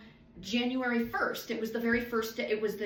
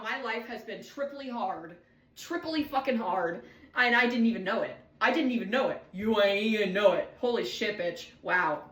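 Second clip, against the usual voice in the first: ordinary talk, nothing marked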